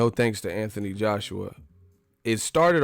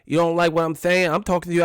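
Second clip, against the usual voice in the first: higher, louder Mike challenge; imitating Mike; 6 semitones higher, 4 dB louder, faster than usual. Mike confronting the interviewer, a bit louder and higher. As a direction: get in his face as Mike. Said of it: "you get all proper".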